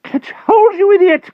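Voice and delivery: very high, loud panicked yell; frantic cry. A narrator's reading. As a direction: shriek it in desperation. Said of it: "cried Merlin frantically".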